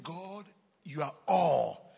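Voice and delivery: slow exalting cry to God; drawn-out praise. Acted. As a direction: stretch it like a prayer.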